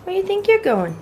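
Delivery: high teasing pet voice; talking to a dog. Real, not acted. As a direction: playfully scold the puppy. Calling after the dog.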